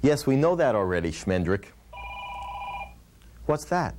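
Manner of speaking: ordinary talk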